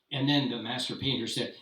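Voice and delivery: ordinary delivery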